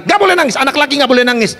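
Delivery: high-pitched parental reprimand; scolding parent. Retold or imitated. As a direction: mimic a parent telling off a son. Voices parent scolding son.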